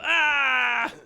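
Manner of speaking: ordinary talk, nothing marked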